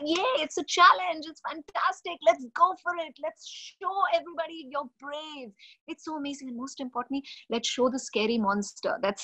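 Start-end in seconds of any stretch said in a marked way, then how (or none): 0.0-6.5 high bright encouragement; cheering. Retold or imitated. Parent cheering a scared child to be brave.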